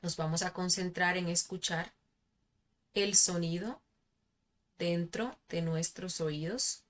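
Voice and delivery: calm, soft meditation guide; guided meditation. Acted. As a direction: lead them gently into relaxation.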